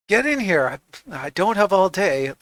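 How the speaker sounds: lightly voiced gruff constable; impatient boss hurrying them in. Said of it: "Stanton goes".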